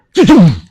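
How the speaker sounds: high, loud double strike sound; explosive strike noise. Shown as a demonstration. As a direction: punch out a quick mouthed "too-toom". Demonstrates an instant double strike.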